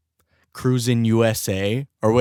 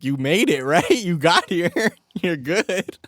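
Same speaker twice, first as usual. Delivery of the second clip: laughing; 8 semitones higher, slower than usual. Retold or imitated. Friend laughs it off.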